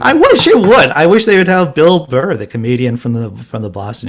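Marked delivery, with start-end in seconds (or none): 0.0-2.4 much louder, high, eager cry; loving the thought of a comic reading the alert; excited outburst. Real, not acted. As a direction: blurt it out, thrilled by the idea. Delighted at the Bill Burr idea.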